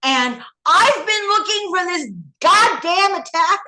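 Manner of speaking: high, loud indignant outburst; furious. Retold or imitated. Confronting her handler about the Israeli video, high furious disbelief. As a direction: demand an answer in disbelief and rage. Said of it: "I was furious".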